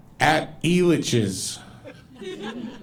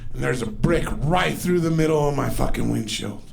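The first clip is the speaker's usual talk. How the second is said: ordinary talk, nothing marked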